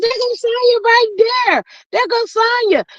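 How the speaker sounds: high excited pointing-out; excited calling out. Retold or imitated. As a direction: spot her and call it out. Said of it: "I said".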